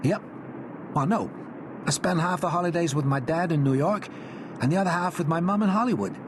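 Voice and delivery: high, breezy American schoolboy showing off; lightly voiced confident American kid; American boy voice. A narrator's reading. Walter voiced in story.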